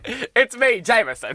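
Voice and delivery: loud, high mock British introduction; put-on British voice. Host introducing himself in the intro, loud mock British accent. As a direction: announce yourself in hammed-up British. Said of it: "I'm a little bit British".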